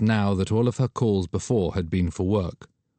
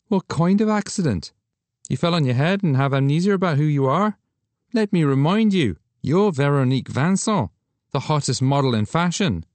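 higher, louder joking friend; Douglas teasing; 8 semitones higher, 4 dB louder than usual. Acted. Friend reminding her who she is, teasing higher voice. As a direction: tease her playfully.

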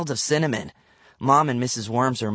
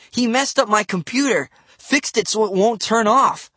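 indignant; 8 semitones higher than usual. A narrator's reading. Angry brother complaining to sister.

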